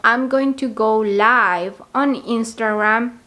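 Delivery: slow, careful model sentence; slow example sentence. Shown as a demonstration. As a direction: spell the sentence out slowly for learners. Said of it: "pueden decir algo así como". (frase de ejemplo lenta y clara)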